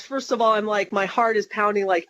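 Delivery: normal talking voice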